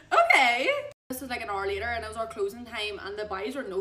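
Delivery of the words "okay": loud, high, excited yes; hammed-up delighted agreement; eager acceptance. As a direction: jump at the invitation, loud and high. Acted eager acceptance of drink invitation.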